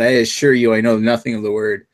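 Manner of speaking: normal talking voice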